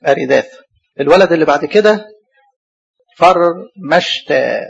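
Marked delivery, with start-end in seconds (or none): 3.2-4.6 فرمشتا very slow, held R and long ending; spelled out by syllables. Shown as a demonstration. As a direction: sound out فرمشتا as farr, mesh, taa. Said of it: "أنا لازم أقعد أستهجاه".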